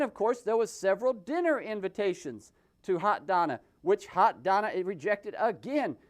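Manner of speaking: ordinary talk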